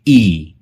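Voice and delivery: ई drawn out slowly as a long ee; long ee sound. Shown as a demonstration. Teacher demonstrating the long vowel slowly. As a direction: stretch ई into a long ee. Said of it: "बड़ी ई का उपयोग".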